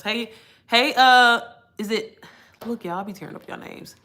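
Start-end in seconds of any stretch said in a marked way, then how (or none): none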